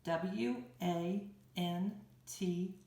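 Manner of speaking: letters "W A N T" said one by one, slowly; slow letter-by-letter spelling. Shown as a demonstration. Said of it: "Here we go".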